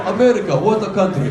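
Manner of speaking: loud, hammed-up Russian comic accent; booming Russian-émigré comedian punchline; Yakov Smirnoff. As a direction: deliver a catchphrase in mock Russian accent. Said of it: "a young Yakov Smirnoff in the crowd".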